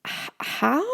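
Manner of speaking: breathless awed stammer; stunned stammer. A narrator's reading. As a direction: stammer in disbelief. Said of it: "I try to mutter".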